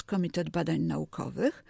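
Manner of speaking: ordinary delivery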